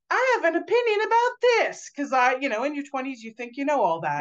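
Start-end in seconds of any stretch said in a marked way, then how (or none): 0.1-1.9 high, loud mock-brash voice; young cocky self. Emailing a famous expert, mock-cocky high voice. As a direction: play your brash twenty-five-year-old self. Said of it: "be like".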